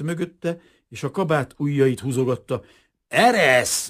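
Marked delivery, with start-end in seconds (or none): 3.1-3.9 loud, higher, gruff command; rough snarl. A narrator's reading. Kazimír to Rozita tugging his coat, snarling roughly. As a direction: shake someone off with a rude snap. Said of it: "mordult rá Gorombán".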